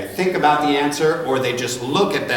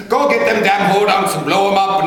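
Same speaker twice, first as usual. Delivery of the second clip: put-on louder, higher game-character Irish accent; Irish accent, raised voice; 5 semitones higher, 5 dB louder than usual. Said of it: "He was an Irish character".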